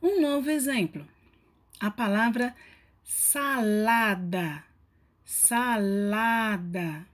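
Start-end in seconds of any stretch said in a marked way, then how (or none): 3.1-4.6 "salada" very slow, stretching the stressed "la"; ênfase na penúltima sílaba. Shown as a demonstration. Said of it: "a sílaba mais forte é a penúltima". 5.2-7.1 "salada" very slow, stretching the stressed "la"; ênfase na penúltima sílaba. Shown as a demonstration. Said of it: "a sílaba mais forte é a penúltima".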